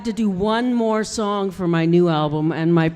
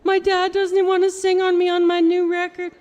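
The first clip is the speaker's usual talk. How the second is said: whiny hurt quote; 10 semitones higher than usual. Retold or imitated. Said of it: "I'm like".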